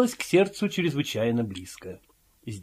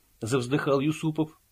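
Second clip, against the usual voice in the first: usual speaking voice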